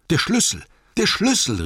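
high, loud excited cry; urgent calling out. A narrator's reading. Bilbo calling for the key at the rock wall, high urgent shout. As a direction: call out urgently at the top of the voice. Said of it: "rief Bilbo".

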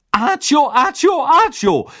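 high, loud repeated spell calls; called out. A narrator's reading. Witch loudly summoning the toffees, one call after another. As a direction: fire off each spell call hard. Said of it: "rief sie".

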